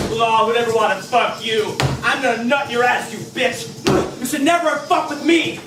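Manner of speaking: vicious ranting. Acted. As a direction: pour out threats with gleeful hate. Harassment spree escalating.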